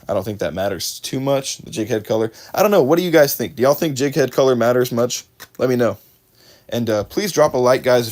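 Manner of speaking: usual speaking voice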